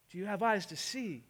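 ordinary delivery

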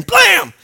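sudden high shouted blam; sharp shouted sound effect; loud blam. Acted. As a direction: slam the sound out like a door. Next level of covering.

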